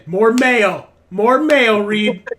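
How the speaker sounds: high, very loud sandwich demand; bossy mock shout. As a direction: bellow orders at Reed, stretching the words. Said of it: "she's bashed her hands like".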